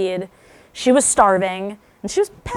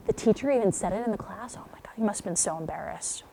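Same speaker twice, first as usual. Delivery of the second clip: whisper; 9 dB quieter, faster than usual. Shown as a demonstration. Parent comforting a child.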